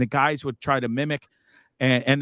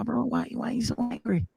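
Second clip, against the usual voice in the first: mock sweet reply to a hater; 10 semitones higher, 6 dB quieter than usual. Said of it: "I interact with them in a nice way".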